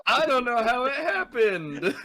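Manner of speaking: high-pitched voice breaking into laughter; laughing mock bafflement. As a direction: plead innocence through laughter. Jokes as the baffled character.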